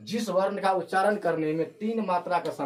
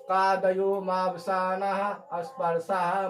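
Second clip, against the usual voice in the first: slow recitation of the rule; much slower than usual. Shown as a demonstration. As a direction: chant the sutra slowly, word by word. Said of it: "बोलिए". (सूत्र धीरे-धीरे पाठ करना)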